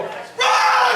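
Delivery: very high sideline scream; urgent, high-pitched yell; frantic shout. Retold or imitated. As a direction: scream it as he takes off. Dad screams at son to run.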